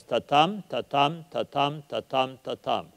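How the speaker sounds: five "tatam" in a row, each ta-TAM, fading toward the end; iambic beat. Shown as a demonstration. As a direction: chant "tatam" five times, stress on TAM. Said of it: "You have five of those".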